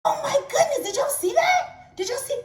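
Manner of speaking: high, laughing amazement. Real, not acted. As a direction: wow the audience. Mom amazed, addressing viewers.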